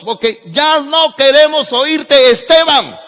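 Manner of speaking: high, loud, furious yell at the preacher; loud mob cry to silence Esteban; angry crowd shouting. Acted. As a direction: shout him down like an enraged mob. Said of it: "Dan grandes voces".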